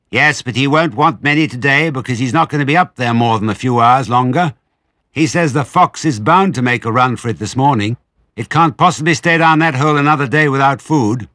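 high, loud, sustained holler; shrill, brisk mistress calling from afar; calling back. A narrator's reading. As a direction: shout the explanation down the steps. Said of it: "the other voice called back".

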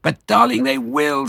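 high, tearful, pleading; sobbing. Acted. Missus Fox answering her husband, high tearful sob. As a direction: plead through mock sobs. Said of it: "sobbed Missus Fox".

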